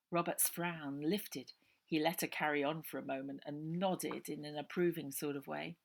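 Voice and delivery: ordinary delivery, nothing marked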